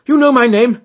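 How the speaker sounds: very high and loud disbelieving question; astonished cry. Acted. Visitor startled that Holmes knows him, loud. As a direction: cry it out in alarm. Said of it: "he cried".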